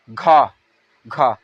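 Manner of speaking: घा with a heavy breathy push; forceful gha. Shown as a demonstration. Teacher demonstrates forceful aspirated gha. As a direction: say घा as if swallowing someone. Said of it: "ऐसा लग रहा है किसी से क्या करेंगे? लील लेंगे".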